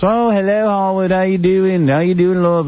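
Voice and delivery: a light, high Australian accent imitating her greeting. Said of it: "she got some Australian accent".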